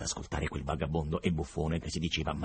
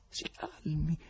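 whispered, soothing si calmi; 9 semitones higher, 5 dB quieter, slower than usual. Acted. Berlioz calming the agitated professor in a whisper. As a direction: hush the madman gently. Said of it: "borbottava Berlioz".

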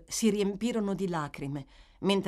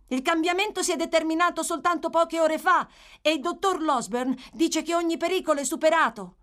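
high, loud, eager account; excited reporting; 11 semitones higher, 6 dB louder than usual. Acted. Boy reporting the doctor's verdict, excited. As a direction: pour out the good news. Boy breaking the good news.

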